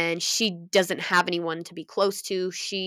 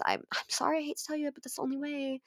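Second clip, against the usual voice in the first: soft apology; 6 semitones higher, 10 dB quieter, faster than usual. Real, not acted. Soft apologetic, much quieter.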